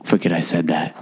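a hint of an owner dropping the subject; stern resort owner. Owner waving off his kitchen remark, lightly voiced gruff farmer. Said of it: "he's like".

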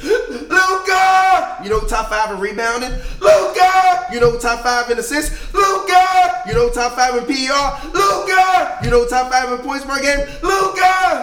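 shouted hype chant, high; loud opinionated ribbing. Real, not acted. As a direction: yell the name after each question. Call-and-response Luka hype.

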